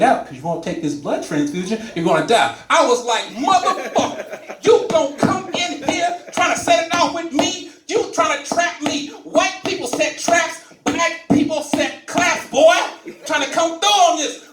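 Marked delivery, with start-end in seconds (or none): 3.3-14.4 hammed-up outrage, much higher; mock indignant. Patient scolding the doctor who tries to trap him, mock outrage. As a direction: call out the trick, high and indignant.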